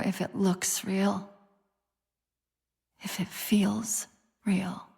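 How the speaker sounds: slow, hushed whisper. Acted. Confiding her fear to the Chief, slow whisper. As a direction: breathe it out slowly, barely audible.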